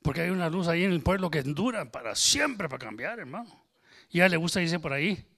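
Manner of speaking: loud, driving sermon voice; preaching at full force. Acted. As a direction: preach it out to the whole room. Preaching to congregation.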